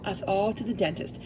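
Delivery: ordinary delivery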